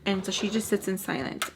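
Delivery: ordinary talk, nothing marked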